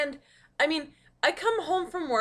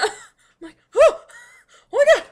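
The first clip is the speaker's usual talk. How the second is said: high, loud laughing exclamation; 13 semitones higher, 14 dB louder, much faster than usual. Real, not acted. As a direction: burst out laughing. Cat sprawled out.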